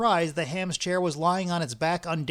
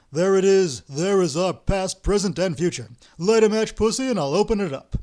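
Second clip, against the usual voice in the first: loud, higher, triumphant announcement; triumphant excitement; 4 semitones higher, 8 dB louder than usual. Acted. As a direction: proclaim the find with glee, loud. Ostap finds the chair, triumphant.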